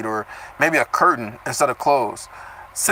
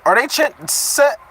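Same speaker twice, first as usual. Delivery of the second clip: normal talking voice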